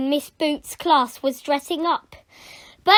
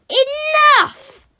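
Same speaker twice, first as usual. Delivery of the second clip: very high, loud bellow; explosive teacher's shout; bawling; 10 dB louder than usual. Acted. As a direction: bawl it to silence the class. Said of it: "bawled Miss Boot".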